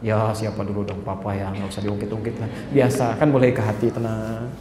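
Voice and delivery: low, soft, smug husband. Retold or imitated. Preacher voices a husband smugly replying to his wife, low and soft. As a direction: brush off the praise, low and lazy.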